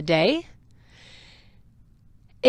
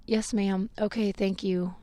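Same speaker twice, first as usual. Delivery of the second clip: soft, defeated compliance; weak, meek reply; 7 dB quieter than usual. Retold or imitated. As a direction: give in weakly, with little volume. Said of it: "really weakly said".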